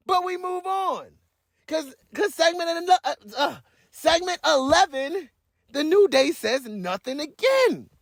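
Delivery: breathless rant about wasted airtime; worked-up rant. Real, not acted. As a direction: vent until you run out of breath. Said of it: "Let me, let me catch my breath".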